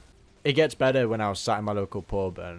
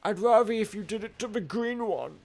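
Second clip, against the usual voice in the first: high pleading whine; 10 semitones higher, 4 dB louder than usual. Real, not acted. Pleading with the game.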